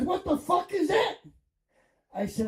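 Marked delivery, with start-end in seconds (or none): 0.0-1.2 wife's shocked shout, raised high; retold shout. Wife yelling at husband who soiled the bed, shocked shout. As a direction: yell it out in disgust.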